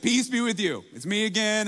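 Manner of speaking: loud cheerful greeting. Retold or imitated. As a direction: call it out brightly to calm a startled room. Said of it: "it was freaking everyone out".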